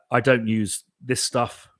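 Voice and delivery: ordinary talk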